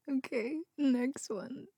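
ordinary talk, nothing marked

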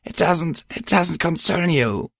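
high, halting brush-off; upset mare. A narrator's reading. Crying mare shuts out her worried friend, upset. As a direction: stammer a high, upset refusal.

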